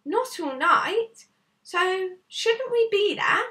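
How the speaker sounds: put-on a worried boy asking if they're there yet; anxious young boy. Boy character voice in story.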